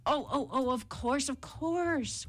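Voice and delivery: high, fluttering mock fear; feigned fright. A narrator's reading. Jackal pretending fear of the tiger, trembling. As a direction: stammer it high, pretending to be scared. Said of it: "pretending to tremble with fright".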